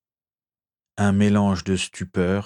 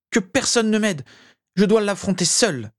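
much higher, urgent cry; defiant shout; 12 semitones higher than usual. A narrator's reading. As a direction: refuse all help, crying out. Defying the crowd, still shouting.

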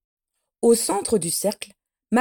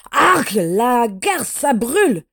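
loud, drawn-out cry of pain; pained yell; 3 semitones higher, 6 dB louder, slower than usual. A narrator's reading. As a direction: howl as if it burns. Man splashed, eyes burning.

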